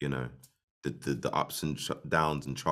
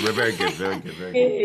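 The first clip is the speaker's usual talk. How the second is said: mock enthusiastic, climbing higher; playful repetition; 5 semitones higher, 7 dB louder than usual. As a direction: say it bouncing up higher each time. Playful repeated answer, rising pitch.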